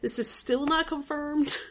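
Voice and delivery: high, drawn-out incredulous question; exasperated disbelief. Acted. Fan fed up waiting for the show to confirm it, exasperated disbelief. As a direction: stretch it out in mock disbelief. Imagined reaction to unconfirmed relationship.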